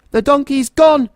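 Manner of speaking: loud, high furious shout; angry shout. A narrator's reading. Goblin shouts to Hobble that the donkey escaped, furious shout. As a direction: yell it out in a rage. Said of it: "shouted Gobble angrily".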